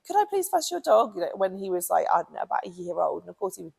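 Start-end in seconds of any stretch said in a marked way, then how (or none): 0.0-1.1 put-on higher, sweet little-child ask; toddler asking sweetly. Toddler asking the owner, high and sweet. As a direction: play a small child asking nicely. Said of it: "a little toddler coming over and saying".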